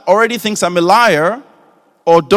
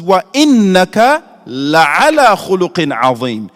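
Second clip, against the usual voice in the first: slow, louder chanted recitation; Quran recitation; 6 dB louder than usual. Acted. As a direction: recite the verse with reverence.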